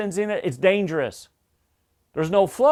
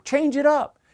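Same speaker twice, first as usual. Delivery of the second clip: higher, lively and emphatic; change it up; 7 semitones higher than usual. Shown as a demonstration. Trainer urging crews to drop the monotone job brief, lively. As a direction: lift the pitch high, away from monotone. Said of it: "We don't want no monotone".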